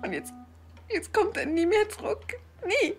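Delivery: slow, high sobbing lament; tearful grief. Acted. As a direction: break into tears while speaking. Widow grieving for husband. (weinend, verzweifelt)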